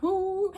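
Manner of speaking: high, loud mock "woo"; hyped-up whoop. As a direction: whoop as if spoiling for a fight. Acts out her hyped-up whoop.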